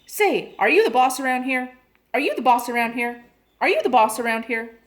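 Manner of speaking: a cheeky parrot yelling at the mother. A narrator's reading. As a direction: hop about and shout it. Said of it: "blinking and yelling".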